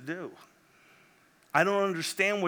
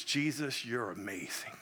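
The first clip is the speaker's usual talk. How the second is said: hushed awe; 4 semitones lower, 7 dB quieter than usual. Retold or imitated. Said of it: "all I could say was".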